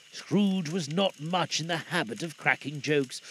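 usual speaking voice

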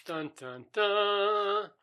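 mock suspense "dun, dun, dun"; dramatic sting. Mock dramatic sting after monster reveal.

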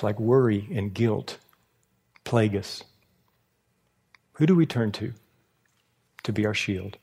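low, measured delivery with long pauses; hushed, reflective preaching. Real, not acted. Preacher asking the congregation who they turn to, low and reflective.

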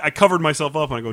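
ordinary delivery

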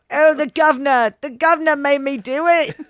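high, loud mock Cockney whine; overdone fake British accent; bad British accent. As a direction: ham up a Cockney excuse. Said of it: "British accent".

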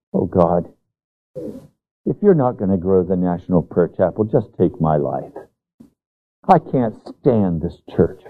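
low, weary, self-pitying complaint; mock despairing prayer. Preacher mocking his own past complaint, low and weary. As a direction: sulk at God like a worn-out pastor. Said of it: "I can't tell you how many times I've done that".

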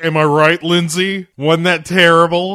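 loud, high, hammed-up teacher jeer; mocking teacher. Teacher mocking the poem to a student, hammed-up jeer. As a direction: play to the class for agreement, loudly. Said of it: "It's like".

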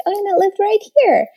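high, fast, playful tickle voice; bright tickle-game voice, high and quick; ticklish and playful. Shown as a demonstration. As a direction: squeal it out to make a child giggle. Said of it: "ticklish and playful".

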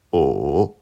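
O dipping low then climbing back up; third tone on O. Shown as a demonstration. Teacher showing learners Mandarin O, dipping tone. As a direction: draw O down and up again. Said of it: "Une dernière fois".

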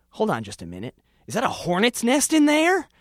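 startled discovery of hornets; alarmed surprise. Acted. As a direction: catch sight of the nest and panic. Narrator spots hornet's nest, alarmed.

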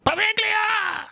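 villain shout. Retold or imitated. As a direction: howl the island's name. Killer shouts before turning crow.

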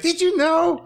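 high mock question; high squeaky voice. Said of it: "I hear it's like".

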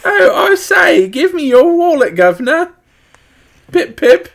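very high, loud British caricature; hammed-up British accent. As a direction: squeal it like a cartoon British gentleman. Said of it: "That's just getting worse".

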